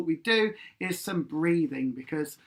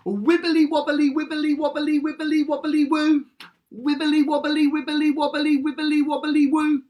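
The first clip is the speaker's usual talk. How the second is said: higher and louder wibbly wobbly; high, loud warm-up; 9 semitones higher, 6 dB louder than usual. Shown as a demonstration. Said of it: "got your mouth moving around".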